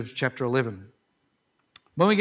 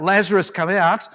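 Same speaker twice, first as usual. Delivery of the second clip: raised commanding call; crying out; 3 semitones higher, 6 dB louder than usual. Retold or imitated. Preacher retelling Jesus's cry to Lazarus, loud call. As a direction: call the dead man out, loud. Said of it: "and Jesus cries out".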